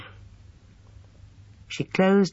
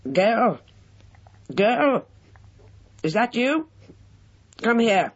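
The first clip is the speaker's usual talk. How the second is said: put-on old man calling; 9 semitones higher, slower than usual. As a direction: call out like a demanding old invalid.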